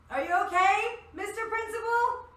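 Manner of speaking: high, loud call across a distance. Acted. Midnight calls to the principal after a clank, loud calling out. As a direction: shout over to check on someone.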